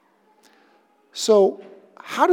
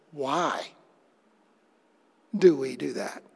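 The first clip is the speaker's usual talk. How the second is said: hushed question; 8 semitones lower, 9 dB quieter, slower than usual. Acted. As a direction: drop low and soft, almost to yourself. Pastor asking a soul-searching question.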